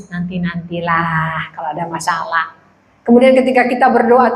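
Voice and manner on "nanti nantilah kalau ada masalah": low, soft, dismissive put-off; mock-lazy reply, dropped low and quiet; ogah-ogahan. Jemaat menjawab Tuhan dengan ogah-ogahan. (jawaban malas tiruan, rendah dan lirih)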